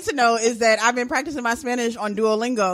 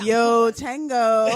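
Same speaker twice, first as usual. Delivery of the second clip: bad Spanish accent; much slower than usual. Shown as a demonstration. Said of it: "I don't sound that bad".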